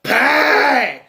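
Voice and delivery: high, loud, delighted yelp; gleeful mock shout. Otis spotting a whole bunch of pies, gleeful high shout. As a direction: squeal it like finding treasure.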